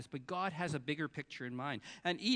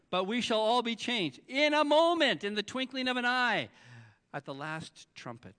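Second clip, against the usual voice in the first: preaching scripture; 7 semitones higher, 8 dB louder than usual. Acted.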